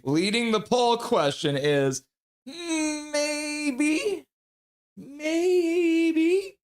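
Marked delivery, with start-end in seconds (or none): 2.5-4.3 high, crying-voiced maybe; mock wail. Streamer reading the leading poll answer, mock crying. 5.0-6.6 high rising squeaky maybe; silly squeak. Pretend. Streamer repeating the poll answer, high squeaky rise.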